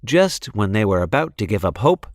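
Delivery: usual speaking voice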